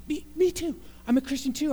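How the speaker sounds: high, eager, delighted agreement; eager me too. Acted. Man on the bridge eagerly agrees with the jumper, high and delighted. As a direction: jump in happily like a new friend.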